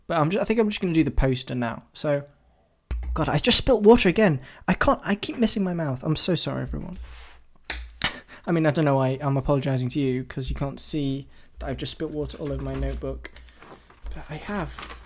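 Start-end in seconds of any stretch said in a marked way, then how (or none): none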